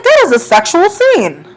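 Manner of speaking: high-pitched mock realisation; dawning realisation. Storyteller replaying the moment it clicked, high exclamation. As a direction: exclaim it high, like it just hit you. Acted realisation of what was meant.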